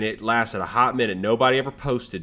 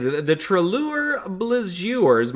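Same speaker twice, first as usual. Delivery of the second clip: announcer voice; 7 semitones higher, 4 dB louder, slower than usual. Acted. Show-name announcement.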